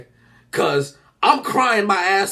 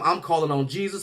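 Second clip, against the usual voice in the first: ordinary talk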